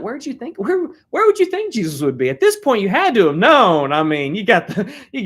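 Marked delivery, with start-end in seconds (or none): 0.0-2.3 Jesus asking. Retold or imitated. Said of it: "he's like".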